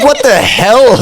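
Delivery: high, loud, laughing disbelief; half-laughing outrage at the twist; shocked laugh. Retold or imitated. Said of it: "I'm like".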